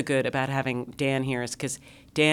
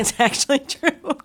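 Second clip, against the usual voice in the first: laughing; 11 semitones higher, 5 dB louder than usual. Real, not acted. Laughing agreement.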